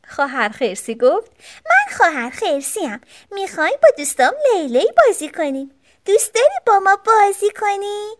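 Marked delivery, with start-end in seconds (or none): 1.6-8.1 put-on high little-girl bear voice; higher childlike storybook voice; sister bear. A narrator's reading. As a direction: pipe up as a young sister bear.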